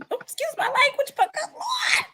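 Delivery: high, fast, still shaken; rattled, giggly apology; flustered apology. Real, not acted.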